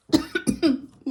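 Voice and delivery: harsh loud cough; coughing fit. Real, not acted. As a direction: cough hard and sudden. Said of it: "my coughing and spluttering".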